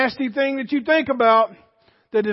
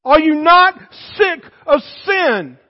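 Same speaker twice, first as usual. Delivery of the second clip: preached shout; 5 semitones higher, 7 dB louder than usual. Real, not acted. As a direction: bring the whole church to its feet.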